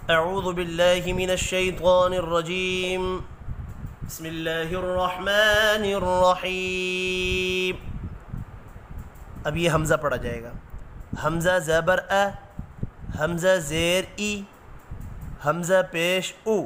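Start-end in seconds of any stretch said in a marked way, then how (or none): 0.1-7.7 slow, measured Quranic recitation. Real, not acted. Teacher opens the lesson reciting bismillah slowly. 11.2-16.6 slow, spelled-out Qaida drill; syllable drill. Shown as a demonstration. Teacher drilling the class through Noorani Qaida syllables.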